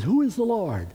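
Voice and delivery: high-pitched scornful challenge; harsh, dismissive retort; harsh answer. Retold or imitated. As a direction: throw the question back harshly. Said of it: "he gives a harsh answer".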